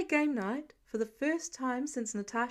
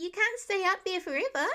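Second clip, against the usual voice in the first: calling up; 7 semitones higher than usual. A narrator's reading. Kid calling up to Peter.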